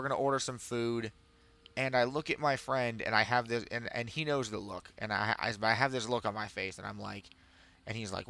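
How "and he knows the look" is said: ordinary delivery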